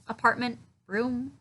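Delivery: normal talking voice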